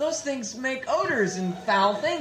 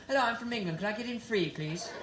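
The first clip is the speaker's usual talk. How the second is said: lightly put-on English accent, trailing off softer; British accent; 2 semitones higher, 8 dB quieter than usual. Comic acting out an Englishman at the door, British accent. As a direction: play a polite English visitor asking. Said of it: "you're talking like a British person".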